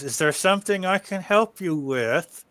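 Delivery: strained politeness through clenched teeth; through gritted teeth. Acted. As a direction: grit your teeth and force the courtesy. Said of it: "As she speaks through her teeth".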